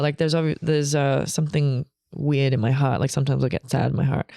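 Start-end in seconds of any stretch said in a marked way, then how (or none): none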